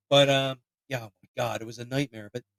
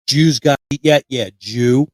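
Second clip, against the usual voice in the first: put-on loud, slurred-together Philadelphia accent; 8 dB louder, slower than usual. Imitating ninth grade teacher explaining the accent, loud Philadelphia accent. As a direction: run the words together in Philly accent. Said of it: "explain the Philadelphia accent".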